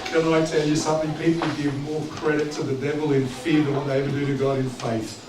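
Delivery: ordinary talk, nothing marked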